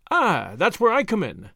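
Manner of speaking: banging a fist on the table as he claims the job; eager cry. A narrator's reading. Julius offering his money to the lawyer, eager cry. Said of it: "cried Julius".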